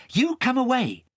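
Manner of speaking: a hint of an urgent boy; lightly voiced boy Kay. Kay pulling Nibbins away, lightly voiced urgent boy.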